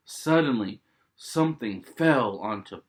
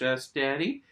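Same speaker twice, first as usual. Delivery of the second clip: ordinary delivery, nothing marked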